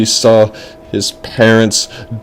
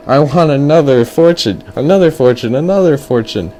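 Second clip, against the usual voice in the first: higher, insistent repeated demand; hyper kid; 4 semitones higher than usual. Acted. Boy demands more from fortune teller, high and hyper. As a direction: pester like a sugar-happy boy. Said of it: "He's like".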